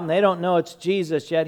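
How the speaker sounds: ordinary delivery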